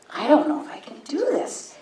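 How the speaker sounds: whispered private worry; retold self-doubt. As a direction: whisper it to yourself, unsure.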